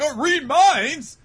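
high, loud, drawn-out shriek; mock shocked echo. Attackers learning of the power, mock shocked shriek. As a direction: shriek it back in hammed-up disbelief.

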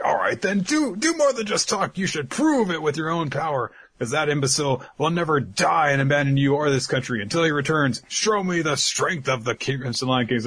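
rally the squad, half-hearted; fiery captain. Retold or imitated. Captain rallies her squad.

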